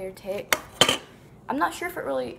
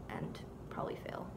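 whispered under the breath; whispered aside; 13 dB quieter than usual. Real, not acted. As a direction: mutter it to yourself in a whisper. Self-deprecating aside while cutting.

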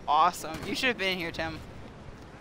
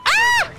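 very high, loud scream; startled shriek; 7 dB louder than usual. Real, not acted. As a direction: shriek in sudden shock. Killed by the minecart.

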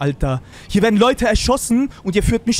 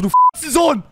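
raised, higher furious yelling; shouting; 4 semitones higher, much slower than usual. Acted. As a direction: demand an answer at full voice. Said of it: "bitte schreien Sie nicht rum". (wütend schreiend eine Antwort fordern)

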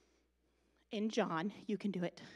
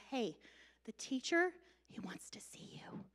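soft unvoiced whisper; 10 dB quieter than usual. Martha whispering to Mary at the mourning, hushed. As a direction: breathe it into someone's ear. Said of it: "she whispers it in his ear".

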